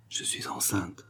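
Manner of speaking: near-whispered confession; very soft, barely voiced admission. A narrator's reading. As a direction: drop to a hushed whisper. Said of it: "elle baissa la voix".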